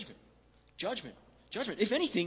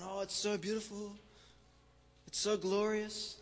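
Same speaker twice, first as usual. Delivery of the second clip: put-on drunken admiration; 4 semitones higher, 4 dB quieter than usual. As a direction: gush dreamily like a deluded drunk. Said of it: "they're drunk".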